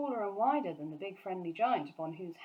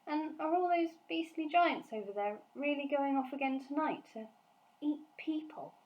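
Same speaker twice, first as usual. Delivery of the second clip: put-on higher, young girl's voice; 8 semitones higher than usual. Sophie questioning the giant, young girl's voice. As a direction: voice the little girl.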